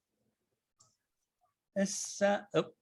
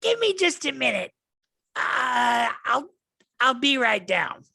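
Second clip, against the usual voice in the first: high, louder, hurried; goblin voice; 10 semitones higher, 5 dB louder, slower than usual. Acted. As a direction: call down that she's coming, flustered. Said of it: "she goes".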